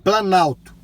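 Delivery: loud clear command; talking to voice assistant. Real, not acted. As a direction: speak up to the car.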